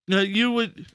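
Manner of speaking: ordinary talk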